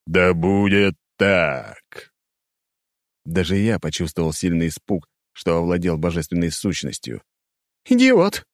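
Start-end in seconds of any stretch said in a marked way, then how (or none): 0.1-2.1 otherworldly echoing voice, very slow; eldritch horror. A narrator's reading. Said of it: "Потусторонний голос ... как эхо". 7.9-8.5 very high, loud hysterical scream; hysterical shriek. A narrator's reading. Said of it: "выкрикнула она истерично".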